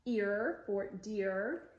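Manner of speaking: slow "Ear for deer", both R endings held; vocalic R demo. Shown as a demonstration. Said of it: "they are called vocalic Rs".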